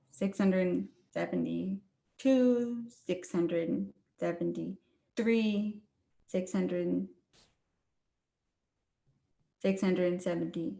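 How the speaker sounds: slow, low monotone count; plodding, drawn-out tallying on a low pitch; laborious hand counting. Acted. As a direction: count aloud painstakingly, with long gaps. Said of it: "That's hand counting, right?".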